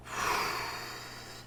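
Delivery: long exhale of held breath; imitated sigh of relief. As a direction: let out a held breath slowly. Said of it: "he lets out this breath".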